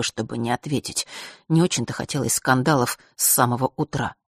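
ordinary delivery

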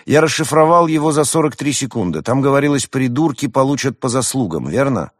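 high, loud, ringing testimony; Roman, confident young hedgehog. A narrator's reading.

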